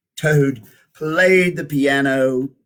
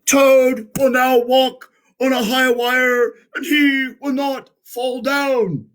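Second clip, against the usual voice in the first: much higher and louder, grand showman proclaiming; strange announcer voice; 11 semitones higher, 5 dB louder than usual. Acted. A strange voice presents Toad to the theater, grandly announcing. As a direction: boom it out like a circus ringmaster. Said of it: "said the voice".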